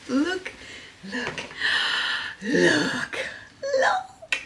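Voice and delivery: high, delighted squeal; giddy high-pitched look look look. Real, not acted. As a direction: squeal with joy at the bag. Overjoyed at the bag.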